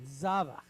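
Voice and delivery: Zavach, long za then short vach; stress on ZA. Shown as a demonstration. Teacher contrasting long and short syllables in Zavach. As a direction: stretch the za of Zavach. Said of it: "Long duration, short duration".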